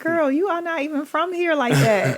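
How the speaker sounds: high, teasing call-out to a cousin; mock scolding a cousin over her fake Jersey accent; sassy mock scolding. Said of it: "And I'm like".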